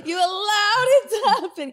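loud, high teasing laughter; laughing. Real, not acted.

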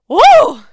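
loud high whoop of shock; shocked loud woo. Real, not acted. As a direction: yell out a big woo. Reacting to shocking quote.

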